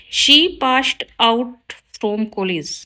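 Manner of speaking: slow, careful read of the wrong sentence; slow teacher dictation. Shown as a demonstration. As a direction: spell it out word by word for the class. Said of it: "wrong है sentence".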